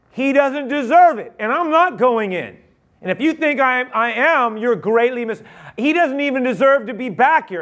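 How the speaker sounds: high, loud angry refusal; older son refusing his father, angry; furious son. Acted. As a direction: spit out the refusal.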